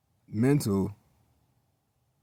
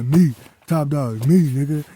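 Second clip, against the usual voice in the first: slow, louder swaggering brag; boasting hype; 3 semitones higher, 5 dB louder than usual. Retold or imitated. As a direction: crow about himself, chest out. Boasting in retold talk to Melanie.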